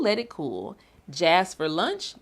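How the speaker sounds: bouncy, sing-song storybook reading; rhythmic read-aloud. Acted. Librarian reading a jazz picture book to children, bouncy and rhythmic. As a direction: read it like a jazzy chant for kids.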